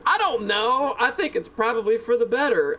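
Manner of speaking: ordinary talk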